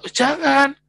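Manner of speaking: high, loud, urgent telling-off; loud pleading rebuke to a harsh father. Retold or imitated. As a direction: stop a father from hitting his child, loud and high. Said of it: "Terus saya bilang".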